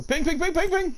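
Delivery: high, rapid-fire frantic repeats; frantic warning. Real, not acted. Player urging teammate to ping, frantic high cry. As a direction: squeal the warning in a panic. Urgent warning during attack.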